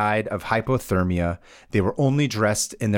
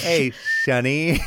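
slow whistling cartoon gopher; 3 semitones higher than usual. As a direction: drawl it like an old gopher. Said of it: "to be able to do it correctly".

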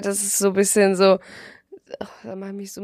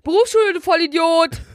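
annoyed shout; 5 semitones higher, 5 dB louder than usual. Real, not acted. Snaps back at friend.

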